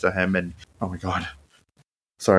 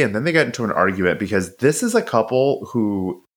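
ordinary talk, nothing marked

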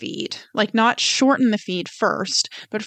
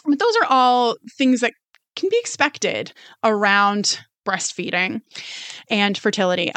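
normal talking voice

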